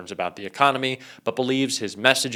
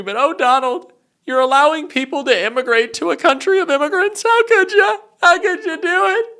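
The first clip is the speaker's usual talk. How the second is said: very high, loud whiny wail of a betrayed fan; mocking MAGA fan; 11 semitones higher, 6 dB louder than usual. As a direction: whine at Donald like a jilted supporter, pitched way up and rising. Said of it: "That's my maga impression".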